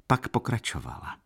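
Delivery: ordinary delivery, nothing marked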